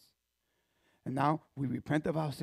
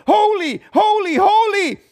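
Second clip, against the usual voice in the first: high, loud ringing cry; angels crying holy; 16 dB louder, slower than usual. Acted. Angels crying out before God, high loud cry. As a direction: ring out like angels. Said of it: "and cry out to God".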